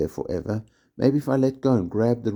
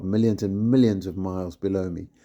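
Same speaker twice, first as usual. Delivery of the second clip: ordinary delivery, nothing marked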